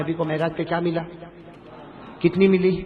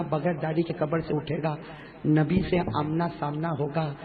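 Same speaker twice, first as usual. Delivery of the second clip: ordinary talk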